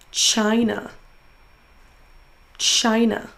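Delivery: CHI in "China" held long; stressed syllable drawn out. Shown as a demonstration. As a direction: lean on the first syllable of "China". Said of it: "en prononçant plus fort la syllabe". (première syllabe de « China » traînée)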